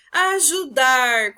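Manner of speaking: loud AJUDAR with a long held DAR; drawn-out AJUDAR, strong on DAR; word called out. Shown as a demonstration. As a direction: call "ajudar" slowly, stretching the last syllable. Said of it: "Vão chamar essas palavras".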